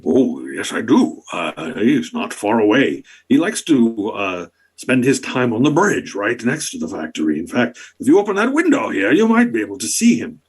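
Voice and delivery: bow and scrape with delight; fawning duke. Acted. Duke Icrind fawning over the monarch, gushing.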